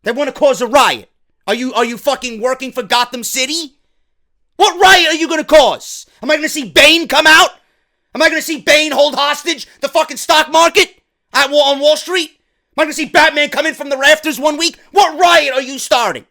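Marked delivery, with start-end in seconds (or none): 4.6-16.2 high, loud mocking disbelief; incredulous rant. Real, not acted. Reviewer mocks wrestlers who promise a riot, incredulous. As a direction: fire off questions in exasperated disbelief.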